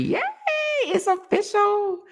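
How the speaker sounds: high-pitched happy squeal of the words; excited squeal. Real, not acted. Host celebrating a viewer's good news, squealing. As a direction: squeal it with delight.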